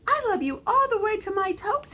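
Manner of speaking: high, fast eager little hare; a tiny bunny showing off upside down. A narrator's reading.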